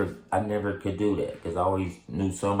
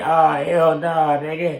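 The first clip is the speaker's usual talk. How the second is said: high, slow, drawn-out protest; retold own protest; 10 semitones higher, 7 dB louder, much slower than usual. Telling the cops, slow and high, in disbelief. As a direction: stretch out the disbelief, pitched way up. Refusing an attempted murder charge.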